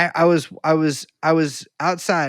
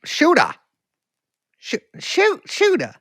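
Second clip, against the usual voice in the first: high-pitched mock Carolina, final r dropped; attempted Carolina accent; 13 semitones higher, slower than usual. Shown as a demonstration. Echoing the movie title, trying out a high Carolina accent. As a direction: try the word high, r-less, in Carolina accent. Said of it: "how do people from here talk?".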